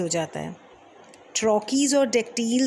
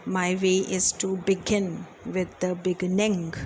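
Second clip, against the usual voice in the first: very slow, evenly weighted syllables; a teacher reciting Byron slowly to show light feet; scanning a verse line; much slower than usual. Shown as a demonstration. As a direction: read it out slowly to let the metre show. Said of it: "एक Pyrrhic भी है जिसमें कि एक ऐसा foot है जहाँ पे दो".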